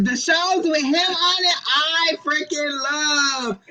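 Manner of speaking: high, drawn-out fan enthusiasm; gushing. Real, not acted. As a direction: gush and stretch out the love. Gushing over a beloved actor.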